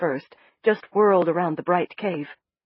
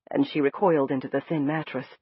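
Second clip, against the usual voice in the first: ordinary delivery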